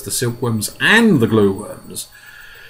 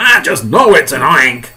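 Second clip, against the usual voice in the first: loud, higher insistent yell; screaming; 4 semitones higher, 9 dB louder than usual. Acted. Fire chief certain about the monster, insistent yell. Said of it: "screamed the head of the fire department".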